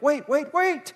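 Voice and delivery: high, loud and urgent "wait, wait, wait"; urgent repeated wait. Shown as a demonstration. Pastor shows how we repeat words for emphasis, urgent cry. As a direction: shout it like you're stopping someone. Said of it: "it's not just sort of wait".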